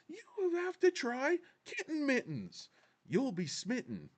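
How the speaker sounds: very high, slow sales slogan; high put-on voice selling the product; pitchman impression. Closing the kitten mittens ad, hammy pitchman.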